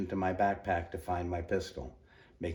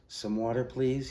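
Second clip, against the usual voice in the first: croaking; 6 semitones higher, 4 dB louder than usual. Acted. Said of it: "he croaked".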